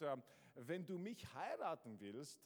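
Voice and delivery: usual speaking voice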